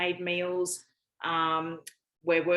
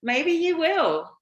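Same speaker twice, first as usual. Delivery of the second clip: higher and louder, slow teasing lilt; playful tease; 10 semitones higher, 8 dB louder, slower than usual. Real, not acted. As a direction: tease him with a sing-song promise. Teasing reply about guest spot.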